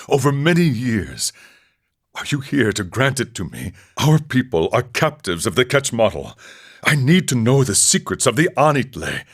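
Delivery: ordinary delivery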